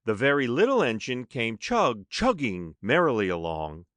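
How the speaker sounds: plain reading voice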